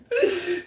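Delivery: high, loud burst of real laughter. Real, not acted. Recalling the slam, a loud real laugh.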